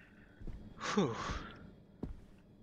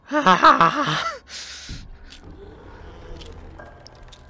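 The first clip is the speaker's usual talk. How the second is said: nervous laugh; 3 semitones higher, 10 dB louder than usual. Real, not acted. Nervous laugh after the scare.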